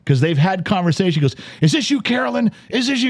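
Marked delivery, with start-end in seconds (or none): 1.6-3.1 screaming caller. Retold or imitated. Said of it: "and he's screaming".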